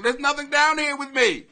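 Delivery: normal talking voice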